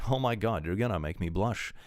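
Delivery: ordinary talk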